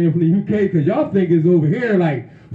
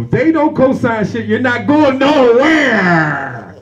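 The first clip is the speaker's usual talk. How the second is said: high, raised voice stretching out the last word; emphatic exclamation; 6 semitones higher, 4 dB louder, much slower than usual. Real, not acted. Host warns everybody about the industry, emphatic high exclamation. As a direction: drive it home, dragging out "nowhere".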